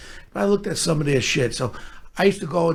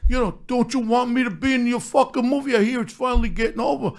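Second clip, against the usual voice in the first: higher put-on movie-star voice; Sly impression; 7 semitones higher than usual. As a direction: imitate a star casually pitching himself. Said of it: "He goes".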